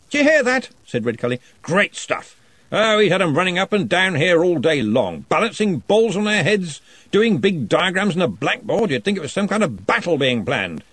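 jovial lordly wizard; booming Arch-Chancellor. A narrator's reading.

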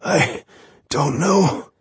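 high, breathless wounded man; gasping. Acted. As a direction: force words out between gasps. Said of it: "Through gasps".

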